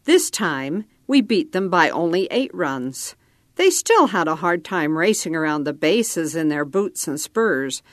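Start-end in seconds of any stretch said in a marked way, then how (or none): none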